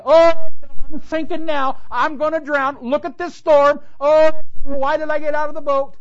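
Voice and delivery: very high, loud, hammed-up wail; mock panicked wailing. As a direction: imitate a drowning man bemoaning his fate. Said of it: "He didn't sit there and say".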